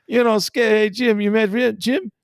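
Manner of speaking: mock-friendly manager imitation; Ozzie Guillen. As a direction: call out cheerfully, hammed up. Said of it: "he goes".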